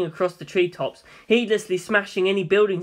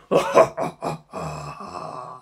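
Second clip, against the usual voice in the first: low evil laugh; 13 semitones lower than usual. Acted. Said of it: "laughed at Dio".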